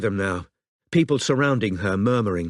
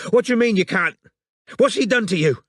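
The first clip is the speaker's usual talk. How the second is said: frantic; 9 semitones higher, 4 dB louder than usual. Acted. Frantic questions down phone.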